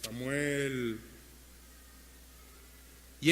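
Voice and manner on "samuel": low, soft, drawn-out call in the night; voice of God. Acted. God calls sleeping young Samuel at night, low, soft calling voice. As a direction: call the name slowly, low and hushed. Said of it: "una voz en la noche que le decía".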